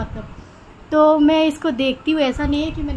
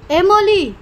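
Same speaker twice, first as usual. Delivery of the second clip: louder, higher call of the name; 6 semitones higher, 8 dB louder, much slower than usual. Real, not acted. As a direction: call the bird to get its attention. Calling the pet bird again. (ऊँची आवाज़ में पुकारना)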